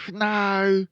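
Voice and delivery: put-on loud, high, drawn-out boy's refusal; high-pitched, loud boy character; refusing Fred. A narrator's reading. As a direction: refuse as an indignant small boy.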